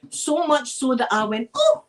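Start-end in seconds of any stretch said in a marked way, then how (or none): 1.6-1.9 high, loud startled yelp; shocked yelp. Retold or imitated. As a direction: yelp as if grabbed. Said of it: "I made that noise".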